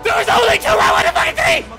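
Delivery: high indignant shout; raging gamer. Real, not acted. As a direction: protest loudly. Outraged at game.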